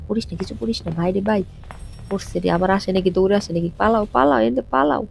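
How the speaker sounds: fast, nervous, rising panic; being chased. Real, not acted. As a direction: flee in a frightened rush. (তাড়া খেয়ে আতঙ্ক)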